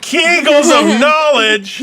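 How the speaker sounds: appalled echo of Kegels of knowledge; incredulous. Real, not acted. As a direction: repeat the phrase in shock. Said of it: "That is a horrible statement".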